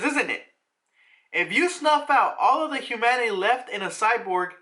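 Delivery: high-pitched angry protest; pissed-off yelling. Retold or imitated. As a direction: raise the pitch and argue back, outraged. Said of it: "he yells and says".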